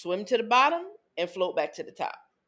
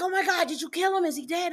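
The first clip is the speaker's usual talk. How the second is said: panicked daughter; 7 semitones higher than usual. Retold or imitated. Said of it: "She's like".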